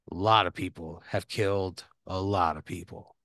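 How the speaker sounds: lightly voiced warden sharing a dark secret. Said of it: "he lowers, he takes his glasses off".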